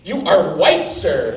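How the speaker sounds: normal talking voice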